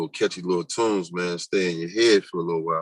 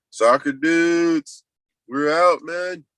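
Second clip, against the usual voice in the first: slow, drawn out and louder; odd put-on voice; 8 semitones higher, 5 dB louder, much slower than usual. Streamer signing off to soccer players, goofy drawn-out voice. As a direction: stretch it out in a goofy voice. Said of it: "I don't even know why I'm talking like that".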